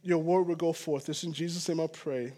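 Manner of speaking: soft, low closing prayer; quiet prayer. Real, not acted. Pastor praying softly before the sermon. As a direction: pray it quietly to close.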